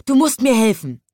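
high, loud urgent plea in a character voice. A narrator's reading. Conductor urgently begs the stationmaster for help. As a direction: beg for help, all in a flurry.

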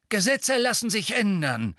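curt, cutting retort; snappish. A narrator's reading. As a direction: snap it back. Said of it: "sagte Fudge bissig".

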